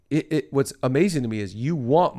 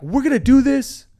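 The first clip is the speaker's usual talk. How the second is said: pumped-up huddle yell, much higher and louder; hyped quarterback rally cry; 11 semitones higher, 7 dB louder than usual. Retold or imitated. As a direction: fire up the huddle like a college quarterback. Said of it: "They're going like".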